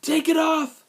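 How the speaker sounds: high, loud shout; shouting boy. A narrator's reading. Boy egging on his brother to strip the rabbit, shouted. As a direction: yell it out as a rowdy boy's order. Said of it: "shouted Amos".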